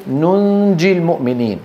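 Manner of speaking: ننجي with a held nasal hum before the jim: nun-ji; hidden second nun. Shown as a demonstration. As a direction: hold the second n of ننجي softened into the j. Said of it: "الأولى منطوقة والثانية مخفاة".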